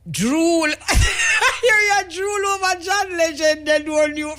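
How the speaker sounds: laughing the words out high and slow; teasing back through a laugh. Real, not acted. Radio host laughing while reading a teasing message.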